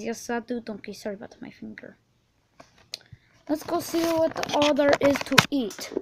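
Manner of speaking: ordinary talk, nothing marked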